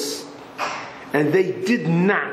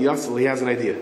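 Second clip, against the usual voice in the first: usual speaking voice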